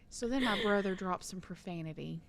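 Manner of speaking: usual speaking voice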